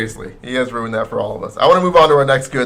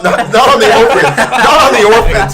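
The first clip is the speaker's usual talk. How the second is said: higher and louder, half laughing; exasperated; 9 semitones higher, 10 dB louder than usual. Real, not acted. Correcting his friend's joke, exasperated and laughing. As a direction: protest it, laughing. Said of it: "for Christ's sake".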